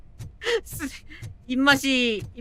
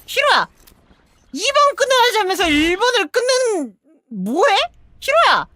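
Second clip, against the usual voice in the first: high, loud exasperated yelling; angry yelling; 7 semitones higher, 6 dB louder, faster than usual. Real, not acted. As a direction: scream at a useless teammate. Yells at teammate Hiro. (화나서 고함)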